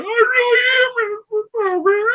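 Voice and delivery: high whiny imitation of a crying new inmate; mock sobbing protest. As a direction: pitch it way up, whimpering. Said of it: "He's like".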